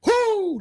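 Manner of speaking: high, sudden "whoo"; appreciative whoop. Real, not acted. Host swoons over Southern accent, high whoop. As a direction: let out an admiring high whoop.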